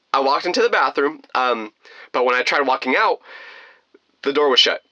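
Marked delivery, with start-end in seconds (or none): none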